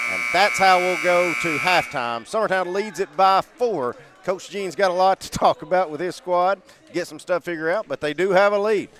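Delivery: excited announcer. Real, not acted. Halftime call, raised voice.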